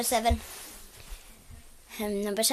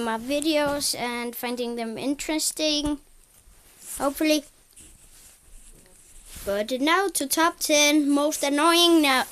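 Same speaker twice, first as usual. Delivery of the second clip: normal talking voice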